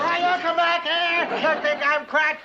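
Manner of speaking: furious, ready to crack a skull; outraged threat. Acted. Captain threatening the phony doctor, angry shout.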